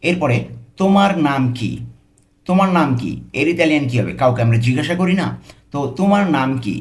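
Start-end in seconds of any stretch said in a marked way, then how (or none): none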